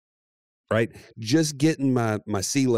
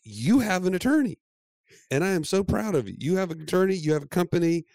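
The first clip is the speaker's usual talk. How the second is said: high-pitched, affirming congratulations; proud husband; 8 semitones higher than usual. Retold or imitated. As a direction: hype up your wife as you retell it. Husband retells congratulating proud wife.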